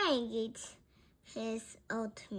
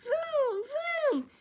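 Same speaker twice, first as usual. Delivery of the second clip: very high, loud vrooms; high-pitched engine noise; motorbike vroom; 5 dB louder than usual. A narrator's reading. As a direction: imitate a revving motorbike. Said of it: "He rides his motorbike".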